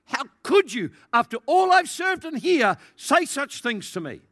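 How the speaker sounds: loud, high-pitched mock indignation; acted angry outburst. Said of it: "And then they get really angry".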